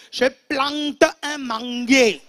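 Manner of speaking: PLANTE and MANGUIER drawn out slowly and loud; emphatic repeat. Acted. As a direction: hammer out "plante un manguier", stretching each word. Repeats line with drawn-out solemn emphasis.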